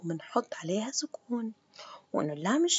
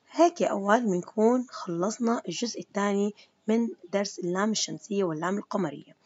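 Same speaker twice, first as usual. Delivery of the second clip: ordinary delivery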